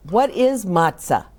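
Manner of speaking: ordinary delivery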